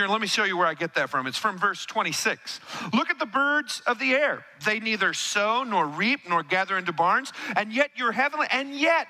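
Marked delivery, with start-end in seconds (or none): 2.9-8.5 scripture reading. Acted. Reads scripture aloud. 8.5-9.1 "and yet" with a high lift on "yet"; preacher cueing "and yet" for the crowd; stress on yet. Shown as a demonstration. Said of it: "everybody say".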